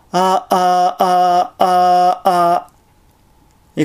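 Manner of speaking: long 'あー' broken by glottal closures; glottal stops. Shown as a demonstration. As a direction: cut the vowel repeatedly with catches in the throat. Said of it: "途中に何回か正門閉鎖を入れながら発音". (声門閉鎖で区切った「あー」)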